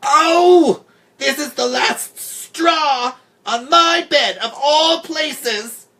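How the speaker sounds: very high, loud shriek with drawn-out words; hysterical, indignant screeching; outraged scream. A narrator's reading. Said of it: "Then she screamed".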